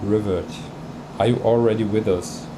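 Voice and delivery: ordinary delivery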